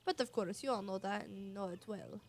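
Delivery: put-on boastful Flavio accent, grandly declaimed; Flavio's accent. Flavio boasting of his glorious return to Rogueport, in his accent. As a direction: play up Flavio's accent like a vain adventurer. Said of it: "I've been doing Flavia's accent".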